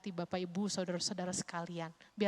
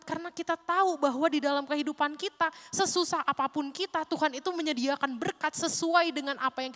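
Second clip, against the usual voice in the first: loud, higher impassioned sermon; fiery preaching; 7 semitones higher, 8 dB louder than usual. Acted. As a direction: preach it to the congregation. Preaching at full force.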